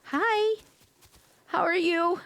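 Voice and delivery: bright greeting. Real, not acted. Greeting someone who seemed to join the voice chat, cheerful. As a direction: welcome a newcomer warmly.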